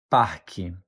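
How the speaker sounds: "parque" with no hard R, like PAH-kee; regional variant. Shown as a demonstration. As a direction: soften the R away in "parque", low falling tune. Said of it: "you might also hear it pronounced as".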